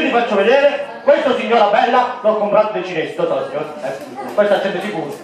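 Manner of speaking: ordinary talk, nothing marked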